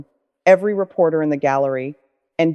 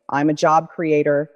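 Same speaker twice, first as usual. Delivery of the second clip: usual speaking voice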